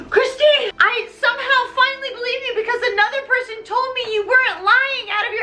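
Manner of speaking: high, fast, breathless gushing; mock frantic outburst. Raoul swimming up to Christine, high frantic gush. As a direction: burst out high and gabble it.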